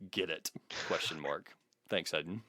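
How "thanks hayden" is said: ordinary talk, nothing marked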